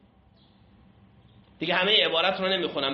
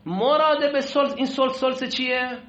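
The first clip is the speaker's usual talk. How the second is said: raised teacher voice; 7 semitones higher, 4 dB louder than usual. Real, not acted. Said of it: "بعضیا تو کلاس اصلاً نیستن".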